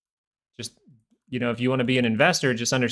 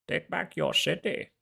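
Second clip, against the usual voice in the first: high, softer mock-villain voice; Bane; 4 semitones higher, 6 dB quieter than usual. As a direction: imitate the villain with a high-pitched, quieter voice. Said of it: "that's how he sounds".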